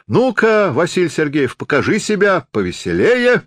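high, animated call to a performer; egging on. A narrator's reading. Spectator eggs the actor on to perform, calling out. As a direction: call him out, pitched well up. Audience member urges the actor on.

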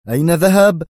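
loud sharp snap; sharp repeat of the question; sharp demand. Acted. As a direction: cut it short, biting. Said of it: "يستطرد في حدة".

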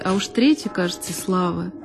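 plain reading voice

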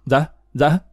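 half-awake, unsure answer; mild sleepy stammer; groggy mumbled reply. A narrator's reading. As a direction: answer as if just woken, lightly acted. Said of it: "mồm ú ớ".

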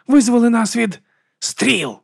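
high, slow, strained outcry; barking. Acted. Arrow being pulled out, barked prayer. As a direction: cry it out with force. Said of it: "гаркнула Віга".

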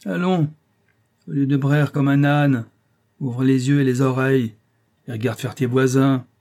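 exasperated teacher lecturing loudly; stern scolding. A narrator's reading. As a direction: harangue the pupil with threats and orders. Teacher scolding beaten pupil.

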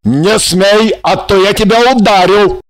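loud angry warning; shouted threat. A narrator's reading. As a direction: warn them off loudly. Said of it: "закричал Жарон".

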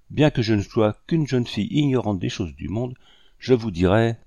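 plain reading voice

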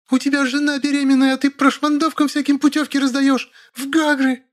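high-pitched scolding wife; hysterical wailing. A narrator's reading. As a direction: berate the husband in falsetto. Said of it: "Маша и заголосила". (голосить истерично, высоко)

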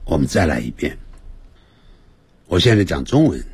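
usual speaking voice

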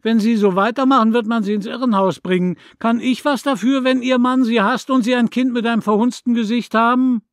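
high, loud, fast taunt; fast cruel shout. A narrator's reading. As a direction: hurl the insult. Neighbour's cruel retort.

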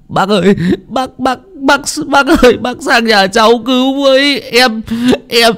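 put-on high, stammering boy's voice in a rush; hurried panicked child. Boy telling old Hà his sibling was taken, panicked. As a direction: tumble out the words, terrified. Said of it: "vội liến thoáng".

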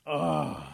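loud groan of disappointment; frustrated groan. Acted. Possum refused by the girls, groaning. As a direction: groan it out, fed up. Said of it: "so Possum groans".

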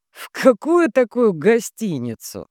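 baffled question, slowly; surprised. A narrator's reading. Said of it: "Жорж удивлённо свёл брови". (удивлённо)

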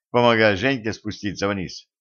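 plain reading voice

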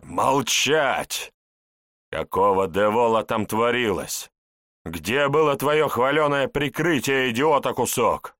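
high, loud furious shout; furious yelling. Acted. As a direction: bellow him down. Boss yells at guest.

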